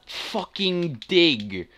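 slow, drawn-out fed-up "Fucking Dig"; exasperated groan. Real, not acted. Gamer groaning at the map choice, exasperated. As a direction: stretch it out in disgust.

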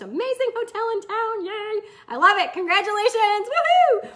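high, loud burst of celebration; excited cheering. Real, not acted. Coach congratulates florist client on hotel deal, excited. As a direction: cheer the good news, ending in a whoop.

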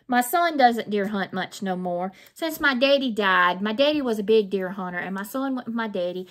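normal talking voice

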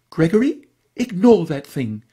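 a prim lady ordering her Great Dane; firm and precise. A narrator's reading. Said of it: "in a firm and precise voice".

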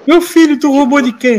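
high-pitched mock maternal alarm; high, reproachful outcry in retelling; alarmed mother. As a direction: act out a mother confronting her son. Mother's alarmed accusation, acted.

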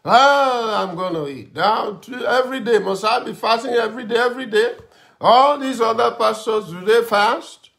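high, loud rapid complaint; mock grumbling. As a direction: rant like a hungry pastor who feels singled out. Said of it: "I'm just grumbling".

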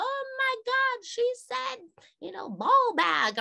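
very high, giggly scandalized student; hysterical kids. Retold or imitated. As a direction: mimic students squealing at a rude word. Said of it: "they're, like, hysterical".

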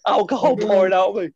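can barely get it out for laughing. Real, not acted. Laughing while retelling his state.

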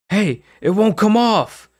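high, loud alarmed cry; panicked cry. Acted. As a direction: panic about the stuck mask. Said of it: "she cried".

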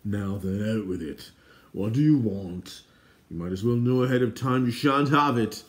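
testy character voice swelling to a refusal; cross king. Acted. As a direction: demand grudgingly as an irritable king. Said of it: "shot a furious glance".

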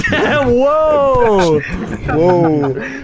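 shocked whoa. Real, not acted. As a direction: cry out, scandalized and amused. Laughing shock at the skit.

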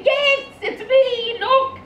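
very high, loud, drawn-out squeal; squealing swan daughter. Acted. Swan daughter answers her shocked father, high comic squeal. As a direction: squeal it out as an excited talking swan.

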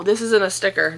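normal talking voice

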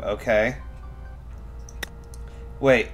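normal talking voice

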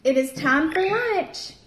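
ordinary delivery

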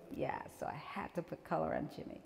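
soft unvoiced whisper, half to herself; whispered aside. Real, not acted. Artist confiding about the color Jimmy, in a whisper. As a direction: let it slip out as a hushed confession.

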